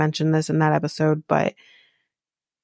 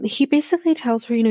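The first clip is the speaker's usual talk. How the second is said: ordinary delivery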